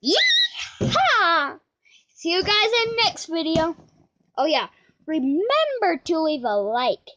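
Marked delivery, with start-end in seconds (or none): 0.0-1.6 much higher shouted yeehaw; cowboy yeehaw. Acted. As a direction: whoop it out like a cowboy. Cowboy yell sign-off.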